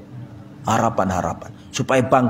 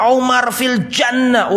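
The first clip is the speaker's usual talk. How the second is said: higher, slow, ringing call; proclaiming; 9 semitones higher, slower than usual. Retold or imitated. Preacher voicing the Prophet's promise to Umar, ringing call. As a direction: announce it like good news.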